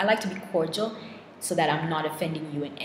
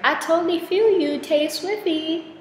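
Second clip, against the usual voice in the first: gushing fan; 13 semitones higher, 8 dB louder than usual. Pretend. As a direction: squeal it like a starstruck fan. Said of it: "So I'm like".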